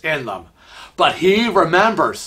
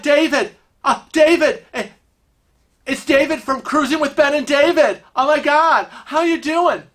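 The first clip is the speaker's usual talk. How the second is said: high-pitched, excited recognition; starstruck fan; 9 semitones higher than usual. Acted. Guy thinks Kevin is David from a show, starstruck. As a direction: gush and repeat yourself, pitched way up. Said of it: "a guy comes up".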